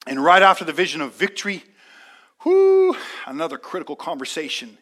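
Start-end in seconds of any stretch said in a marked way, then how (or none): 2.4-3.0 high, loud whoo; sudden joyful shout; excited whoop. Acted. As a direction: let out a preacher's whoop.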